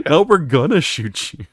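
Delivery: high, loud comic protest; mock exasperation. Squad warning the friend without armband, mock exasperation. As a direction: imitate exasperated teammates, half laughing.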